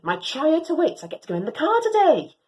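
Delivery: ordinary delivery, nothing marked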